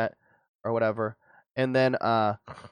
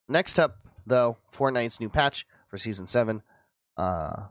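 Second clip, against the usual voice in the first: normal talking voice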